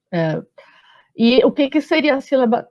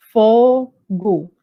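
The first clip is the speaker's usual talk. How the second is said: "fogo" split into two drawn-out syllables, fo, go; sílaba por sílaba; 3 dB louder than usual. Shown as a demonstration. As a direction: scan "fogo" slowly, pausing between fo and go. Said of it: "a gente confere assim". ("fogo" escandido devagar, fo-go)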